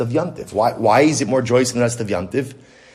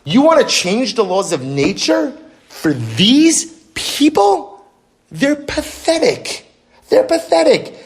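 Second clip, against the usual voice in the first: much higher, scornful voice for the sea; sea's mocking complaint; 10 semitones higher than usual. The sea mocks the people to God in a high scornful voice. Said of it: "It's not an exact quote".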